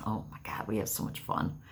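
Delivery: whispered oh my God; hushed gush. Real, not acted. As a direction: breathe it out in wonder. Gushing about friends date.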